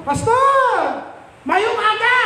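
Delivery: high greeting hollered from afar; drawn-up, carrying hail; calling out. Retold or imitated. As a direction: call out eagerly to someone far off. Calls greeting to distant pastor.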